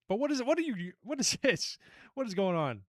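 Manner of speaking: high-pitched, incredulous questioning; baffled disbelief. Real, not acted. Host baffled by what is going on, incredulous. Baffled reaction to quiz answers.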